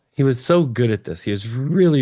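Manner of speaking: ordinary delivery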